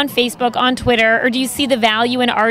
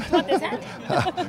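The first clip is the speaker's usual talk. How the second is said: slow, laughing question; 4 semitones higher, 9 dB quieter, much slower than usual. Real, not acted. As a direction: laugh through it. Laughing over the hat joke.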